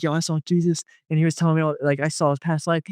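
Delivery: excited retelling. Retold or imitated. Telling a friend he saw Jesus, excited. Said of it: "I was excited".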